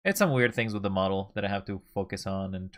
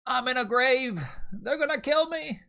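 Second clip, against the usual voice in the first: high squealing panic; mock panic; 14 semitones higher than usual. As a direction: shriek it like you're doomed. Mock panic at being in grave.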